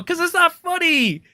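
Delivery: annoyed outburst; heated rebuttal. Real, not acted. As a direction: insist it's not funny. Angry rebuttal.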